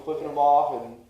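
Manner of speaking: ordinary delivery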